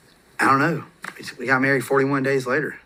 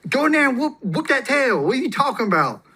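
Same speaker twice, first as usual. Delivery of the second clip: much higher, amused wife voice; laughing wife; 12 semitones higher than usual. Retold or imitated. Wife laughing at husband who can't discipline the kid, high and amused. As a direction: tease him in a high laughing voice. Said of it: "She starts busting out laughing".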